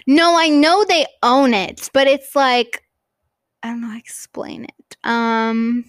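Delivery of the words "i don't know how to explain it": soft unvoiced aside; whisper. Real, not acted. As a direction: whisper it to yourself.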